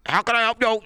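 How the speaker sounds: high, loud voice on a garbled line; phone sound effects. Shown as a demonstration. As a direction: imitate a terrible phone connection. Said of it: "I'm trying to do phone sound effects".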